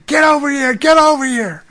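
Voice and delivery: high, loud, urgent calling out; urgent shout. Retold or imitated. Mafia man yelling at his counselor to come over, urgent shout. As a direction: yell him over, desperate.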